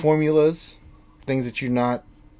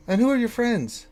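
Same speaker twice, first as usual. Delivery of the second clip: higher-pitched, distracted tinkerer; raised voice of a preoccupied tinkerer; absent-minded tinkerer; 11 semitones higher, 3 dB louder than usual. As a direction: play a busy inventor caught mid-task. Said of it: "he's like".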